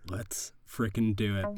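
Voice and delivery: usual speaking voice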